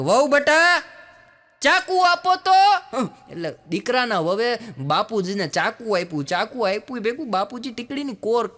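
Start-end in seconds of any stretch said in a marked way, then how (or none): 0.0-2.7 put-on loud, high, slow call of an old father to his daughter-in-law; old man calling out. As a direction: holler it across the house, a high drawn-out call. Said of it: "રાડ પાડી કે".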